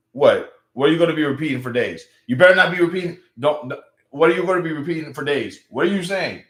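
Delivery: mock-outraged pushback; alarmed challenge. Real, not acted. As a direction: demand to know what they mean. Mock challenging a viewer's remark.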